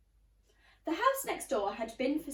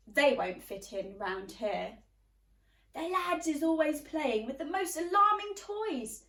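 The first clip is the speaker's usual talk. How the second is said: usual speaking voice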